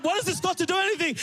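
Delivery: high, fast mock-exasperated retort; hammed-up protest to dad; retold yelling back. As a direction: fire back fast and high, acting indignant. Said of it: "I'm yelling back at him".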